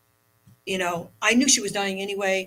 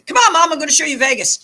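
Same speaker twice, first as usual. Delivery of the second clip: much higher, louder, fast and gleeful; playful excitement; 8 semitones higher, 10 dB louder, faster than usual. Retold or imitated. She jokes to her mother's ashes in Vegas, loud and gleeful. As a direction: cheer it out like a joke to Mom.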